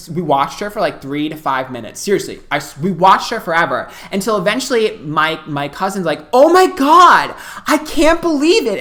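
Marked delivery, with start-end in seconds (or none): 6.3-8.8 high, loud mock exclamation; loud disbelief. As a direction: imitate a loud cousin who can't believe it. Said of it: "And she's loud".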